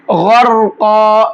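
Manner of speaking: slow, drawn-out melodic tilawat; Quran recitation. Shown as a demonstration. Teacher opens the lesson reciting slowly for students. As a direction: chant each word slowly, stretching the vowels. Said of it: "پر کر کے پڑھیں گے".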